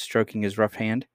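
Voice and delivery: ordinary delivery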